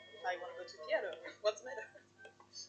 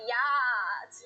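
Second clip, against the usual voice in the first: party yacht; 7 dB louder than usual. Shown as a demonstration. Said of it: "You're going to party".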